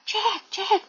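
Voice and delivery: high-pitched acted call. Teacher voices Annie calling to Jack, high mock call. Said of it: "막 이러면서 불러요".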